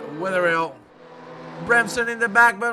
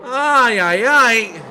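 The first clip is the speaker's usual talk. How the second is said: loud higher ay ay ay cries; dismayed shout; 4 semitones higher, 9 dB louder, much slower than usual. Real, not acted. Commentator crying out as the poor man locks his tires. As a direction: yell in dismay at the crash. Continued reaction to the crash.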